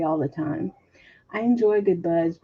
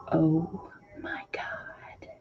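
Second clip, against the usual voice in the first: whisper; 6 dB quieter, much slower than usual. Real, not acted. Savoring the cake memory.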